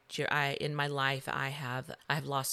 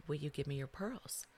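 hushed father; 7 dB quieter than usual. Retold or imitated. Dad asks for the pearls.

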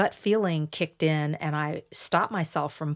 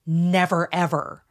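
slow, loud, stressed "never ever"; emphatic; 5 dB louder than usual. Retold or imitated. Narcissist insisting to deny something, emphatic. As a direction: land hard on each word of "never ever", imitating insistence. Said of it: "narcissistic individuals use things like".

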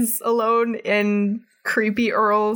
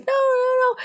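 mock panicked shout; faster than usual. Earl panics as everyone scores holes in one, high frantic squeal. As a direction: squeal it like a man watching his scam collapse.

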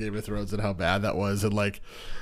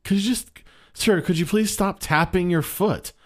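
high, meek polite plea; higher, meek polite voice; polite request; 9 semitones higher, 4 dB louder than usual. Retold or imitated. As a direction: timidly ask the loud man to stop. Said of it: "I just wish I had the balls to be like".

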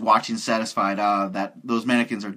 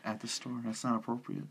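hushed; 10 dB quieter than usual. Real, not acted. Joking about maintenance guy.

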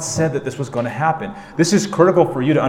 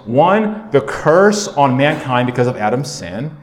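ordinary talk

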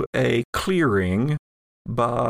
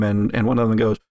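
ordinary delivery

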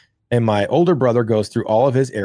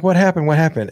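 put-on mom rushing out, asking the boys what happened, in a raised alarmed voice; mom's alarmed call; 6 semitones higher, faster than usual. Mom runs out hearing screams.

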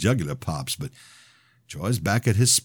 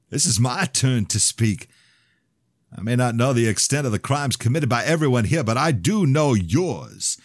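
high, loud fervent preaching; 6 semitones higher, 4 dB louder than usual. Acted. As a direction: preach it like a fire sermon. Preaching to congregation.

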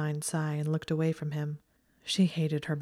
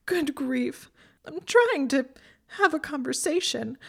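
much higher, louder tearful whine; whining through tears; 9 semitones higher, 4 dB louder than usual. A narrator's reading. Hermione sobbing, frustrated she cannot talk to Draco. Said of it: "she was whining through her tears".

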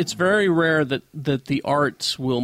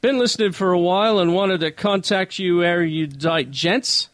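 attempted British with an Australian twang; badly faked British, still Australian; British accent gone Australian. Reader voicing Tom's letter to the hosts, accent stuck Australian. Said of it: "Still Australian".

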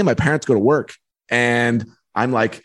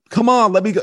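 high-pitched mock pleading with mom; pleading teen; 9 semitones higher than usual. Kid calling mom from the school payphone, pleading. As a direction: re-enact a kid begging his mother.

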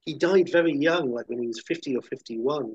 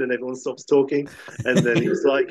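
ordinary talk

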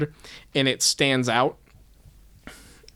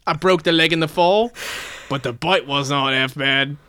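wobbly Britstralian attempt at British; bad British accent; 4 dB louder than usual. Shown as a demonstration. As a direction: do an overdone, not very good British accent. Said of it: "It's not a good accent".

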